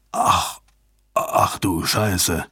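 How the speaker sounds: slow, low stunned murmur. A narrator's reading. Jabba suddenly seeing the message, stunned. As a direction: let the realization sink in, low and slow.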